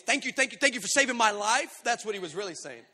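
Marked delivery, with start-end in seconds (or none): 0.0-1.7 imitated grateful kid. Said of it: "What he was really saying was".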